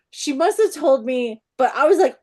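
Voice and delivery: ordinary delivery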